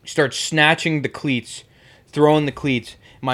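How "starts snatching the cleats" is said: ordinary delivery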